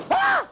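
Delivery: piercing, very high and loud scream; sudden shriek. Acted. Puppet Rudy shrieks at the front row, very high and loud. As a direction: shriek to jolt the audience.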